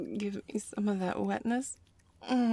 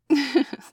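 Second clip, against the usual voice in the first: startled laugh; 8 semitones higher, 10 dB louder than usual. Acted. Startled laugh at rough play.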